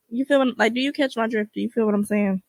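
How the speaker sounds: ordinary talk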